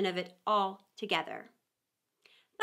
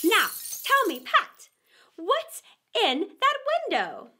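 high, louder scornful repeat; angry rabbit; 8 semitones higher, 6 dB louder than usual. A narrator's reading. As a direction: throw his words back at him, annoyed. Rabbit questions Pat.

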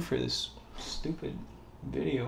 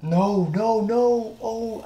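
dismayed cry; 11 semitones higher, 6 dB louder, much slower than usual. Real, not acted. Mistake happening.

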